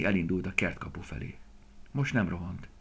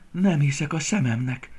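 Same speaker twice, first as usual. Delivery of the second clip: delighted, higher and louder; happy disbelief; 8 semitones higher, 8 dB louder than usual. A narrator's reading. Young deer thinking happily at the haystack, delighted and raised. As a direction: marvel happily at a lucky find. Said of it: "gondolta boldogan".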